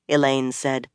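usual speaking voice

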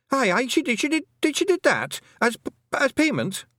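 very high, stuttering Scots excuse; panicked stammer. A narrator's reading. Rob panics, stammering his excuse.

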